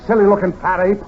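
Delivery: heated insult, high and fast; angry. Acted. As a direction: snap the insult at him. Comics quarrel backstage.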